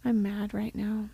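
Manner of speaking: hushed, voiceless plea to God; whispered prayer. Retold or imitated. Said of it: "I was like".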